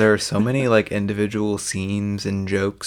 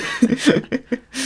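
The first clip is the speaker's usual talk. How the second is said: high squeal laugh. Real, not acted. Laughing at stork bit.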